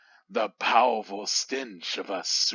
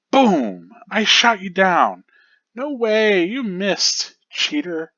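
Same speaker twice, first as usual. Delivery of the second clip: put-on high, loud kid voices; bickering children at play; childish shouting match; squabbling kids; 8 semitones higher, 6 dB louder than usual. Kids arguing over a game.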